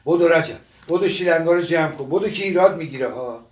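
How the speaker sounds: loud, high, flustered commands; urgent shouted orders. Acted. Old man rushing his helper before the inspection, shouting urgently. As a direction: hurry everyone along at a yell.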